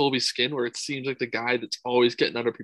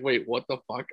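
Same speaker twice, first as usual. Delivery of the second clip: mock confusion. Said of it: "He's like".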